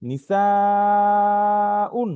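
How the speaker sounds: নিসাউন chanted high, the "সা" held very long; long drawn madd. Shown as a demonstration. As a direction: sing out নিসাউন in recitation style, stretching the "সা" far past normal. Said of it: "বেশি টান দিয়ে পড়তে হবে". (নিসাউন-এর "সা" অনেক লম্বা টেনে সুর করে তিলাওয়াত)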